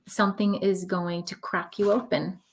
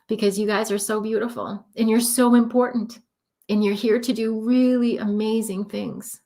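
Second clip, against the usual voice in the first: teary-eyed. Real, not acted. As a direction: speak through welling emotion, real and heartfelt. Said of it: "I'm getting all teary-eyed".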